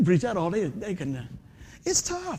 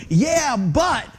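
loud, higher-pitched objection; protesting; 5 semitones higher, 6 dB louder than usual. Acted. Believer arguing back at the Lord, acted loud protest. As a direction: push back loudly, leaving "but" hanging upward.

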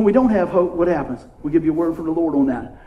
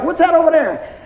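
mock grandma shouting, pitched up; grandma's sharp call; 9 semitones higher than usual. Grandmother calls across the Yahtzee table, pitched up. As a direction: play grandma calling out to distract.

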